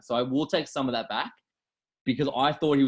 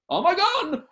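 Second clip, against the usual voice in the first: high, loud mock cry of alarm; hammed-up shriek imitating a fake reaction; mock shocked exclamation; 6 dB louder, slower than usual. Podcaster mimics old lady losing her gun, hammed-up alarm. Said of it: "and she's like".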